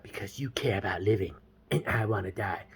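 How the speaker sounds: put-on low, hushed emo girl; emo gloom. Girl confesses to James she wants to die, emo. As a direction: sink into mock-gloomy near-whisper. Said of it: "she pulled, like, a full emo card".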